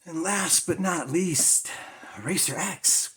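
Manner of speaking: loud, slow, hyped-up announcement; excited. Real, not acted. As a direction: announce it like a big reveal. Big reveal of last comic.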